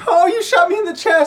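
high, loud wailing voice; hammed-up agonized squeal; wounded Morty. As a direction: cry out as the shot character.

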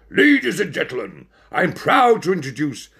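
high, loud showman announcement; ringmaster. Acted. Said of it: "announced the ringmaster".

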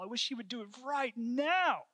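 high, rising cry of frustration; impassioned longing. Real, not acted. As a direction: plead it out, climbing to the last word. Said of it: "that's exactly how I feel".

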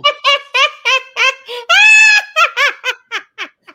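big shrieking laugh; howling laugh; loud burst of laughter. Real, not acted. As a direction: cackle out loud at the joke.

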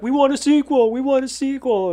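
high-pitched imitation of an eager audience; cheering crowd. Retelling the cast party audience's demand, mock crowd chant. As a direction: mimic fans clamoring together. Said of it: "everyone clapped and was like".

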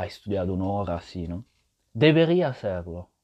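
ordinary talk, nothing marked

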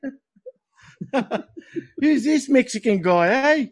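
hammed-up Aussie bloke. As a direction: put on a broad Australian accent.

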